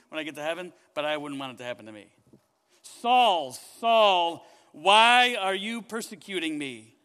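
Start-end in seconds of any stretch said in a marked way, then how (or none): 2.8-7.0 loud, slow, commanding divine voice calling from above; booming voice of God. Acted. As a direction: thunder it out like God stopping Saul cold. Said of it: "I don't think I ever wanna hear God speak like this".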